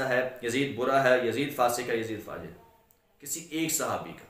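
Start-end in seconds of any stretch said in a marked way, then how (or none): none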